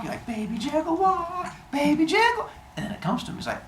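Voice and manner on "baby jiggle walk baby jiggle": put-on high singsong baby-soothing voice; silly high sustained singsong; baby jiggle walk. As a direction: play a dad bouncing a baby, pitched way up. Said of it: "he's doing the baby jiggle walk".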